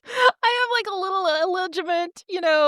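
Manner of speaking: mock sheepish confession. Lily admitting her secret to her husband, mock sheepish. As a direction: squeak out an awkward, mock confession.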